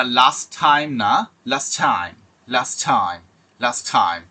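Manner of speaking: লাস্ট টাইম, the final t of লাস্ট dropped into টাইম; লাস্ট টাইম as one linked phrase; last time with one t. Shown as a demonstration. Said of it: "দুটো টি যখন একসাথে".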